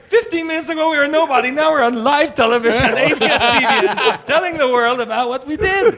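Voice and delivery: high, laughing, excited; bubbling over with glee; giddy fans. Retold or imitated. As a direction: gush about the moment. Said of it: "they're like".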